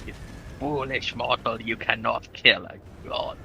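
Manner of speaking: cold rasping evil emperor. Acted. Mock villain taunt in strategy game.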